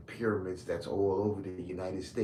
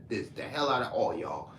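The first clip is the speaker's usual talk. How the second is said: usual speaking voice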